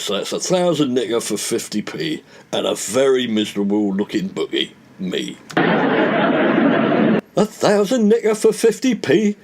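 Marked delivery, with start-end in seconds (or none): 5.6-9.4 amazed disbelief. Acted. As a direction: drag out "fifty P" slowly, high and amazed. Hancock repeats payout amazed.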